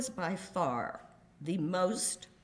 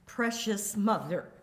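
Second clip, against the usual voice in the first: ordinary talk, nothing marked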